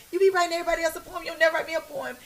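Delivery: high, fast mock complaint; imitating own nagging. Friend recalling how she begs for poems, mock nagging. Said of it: "I often always be like".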